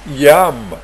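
usual speaking voice

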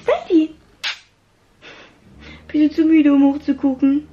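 high, sing-song talk to a dog; pet talk. Real, not acted. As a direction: coax a sleepy dog. Talking to her tired dog.

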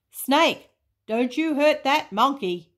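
high, loud warning; loud call. A narrator's reading. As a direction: call it out sharply at the snake. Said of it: "Boo-hoo called loudly".

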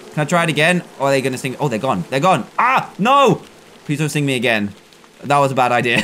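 ordinary talk

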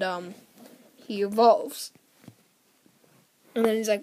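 normal talking voice

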